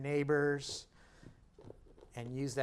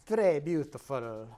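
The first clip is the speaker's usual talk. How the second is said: mock French; 4 dB louder, slower than usual. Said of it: "Well, très is French".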